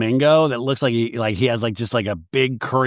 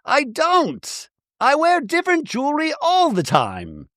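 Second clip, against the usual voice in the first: much higher, airy defensive Bronwyn; high Bronwyn impression; 11 semitones higher than usual. Mocking Bronwyn explaining the missing ring, high and airy. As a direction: chirp the excuse up high. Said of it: "And Bronwyn's like".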